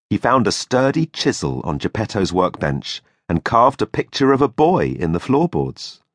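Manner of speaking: plain reading voice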